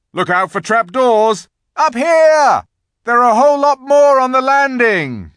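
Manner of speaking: high, loud calls across a castle; excited crying out. A narrator's reading. Searchers call out their finds through the castle, excited shouting. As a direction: shout it out like searchers calling to each other. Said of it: "everyone's voices crying out at once".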